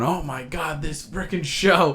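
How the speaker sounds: high-pitched mock scream, held back in volume. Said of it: "I'd be screaming".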